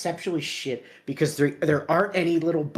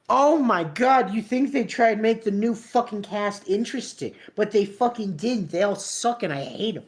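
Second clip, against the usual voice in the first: exasperated rant; 7 semitones higher than usual. Real, not acted. Ranting about new cast.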